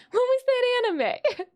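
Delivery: high, cracking-up question; giggling question; laughing. Real, not acted. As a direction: ask while laughing helplessly. Cracking up while asking.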